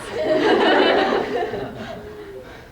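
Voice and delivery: high, hearty burst of laughter; delighted laugh. Acted. Widow relishing her late husband's fights, laughing high.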